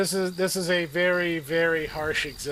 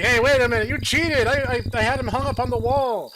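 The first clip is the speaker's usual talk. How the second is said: outraged protest; 7 semitones higher, 7 dB louder, faster than usual. Retold or imitated. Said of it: "now I'm just like".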